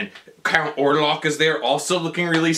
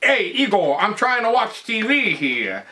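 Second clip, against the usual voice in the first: higher, hammed-up old-man character voice; Grandpa Munster; 5 semitones higher than usual. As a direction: call to a servant in a put-on voice. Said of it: "Grandpa's Munster's like".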